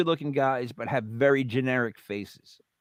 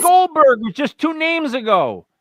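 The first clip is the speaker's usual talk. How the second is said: higher and louder defensive rant; exasperated loud; 10 semitones higher, 8 dB louder than usual. Real, not acted. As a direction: push back, exasperated and loud. Defensive outburst at friend.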